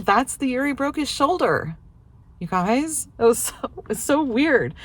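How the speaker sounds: you guys, it's so weird, up high; amazed. Real, not acted. Wife tells viewers the strange news, amazed and high. Amazed at husband's time confusion.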